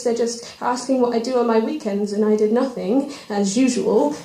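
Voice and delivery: ordinary talk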